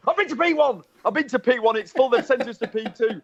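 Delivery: high-pitched, exasperated outburst; retold angry rant in a high voice; angry driver. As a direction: protest indignantly, voice pitched right up. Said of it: "he's so angry".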